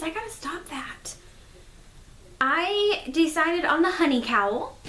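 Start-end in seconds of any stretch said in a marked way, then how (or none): 0.0-1.2 a quick breathy aside, self-scolding; whispered self-reproach. Real, not acted. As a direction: whisper it to yourself. Self-aside about saying um.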